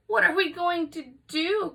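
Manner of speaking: high, mother crying out in alarm; a worried mother's pleading cry; anguished cry. Acted. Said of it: "cried Missus Cream".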